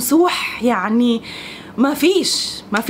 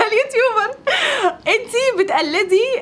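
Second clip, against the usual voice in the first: high voice breaking into giggles; laughing through the words; real laughter; 8 semitones higher, slower than usual. Real, not acted. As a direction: crack up while trying to carry on. Cracks up while listing comments.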